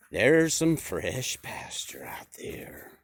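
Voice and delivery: hushed, slow whisper to the flock; secretive whisper. Acted. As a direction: let him whisper the secret slowly. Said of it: "And Bob says".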